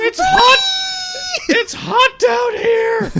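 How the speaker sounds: very high, feminine shriek; high-pitched Night King. Pretend. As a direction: squeal it like a girly villain. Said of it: "super high-pitched and very feminine".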